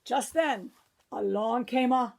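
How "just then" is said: ordinary delivery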